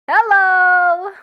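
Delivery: high, loud call out; loud hello. Acted. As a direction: shout a bright hello across the room. Loud greeting in bee movie.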